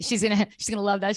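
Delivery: ordinary talk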